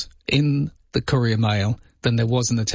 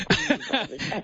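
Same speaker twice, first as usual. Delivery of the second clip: a high burst of laughter; 12 semitones higher, 5 dB quieter than usual. Real, not acted. Host laughs at caller's Albo joke, high laugh.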